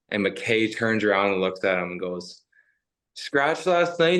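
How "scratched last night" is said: higher, slow mocking question. Player taunting a scratched opponent, slow mocking. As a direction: needle him with a fake innocent question.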